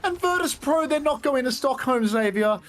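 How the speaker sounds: high, breathless aftermath call; esports caster. Real, not acted. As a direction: still riding the high of the kill. Call after the decisive kill.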